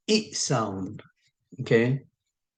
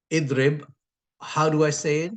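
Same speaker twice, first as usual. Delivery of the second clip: ordinary delivery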